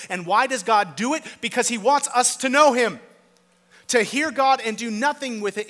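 loud, driving sermon delivery; preaching at full force. Acted. Pastor urging the congregation, loud and fervent. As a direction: build the question and answer with fervour.

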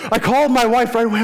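Tony telling the pastor he called his wife, quick and high; excited. Retold or imitated. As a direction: rush it out, thrilled. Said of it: "he's like".

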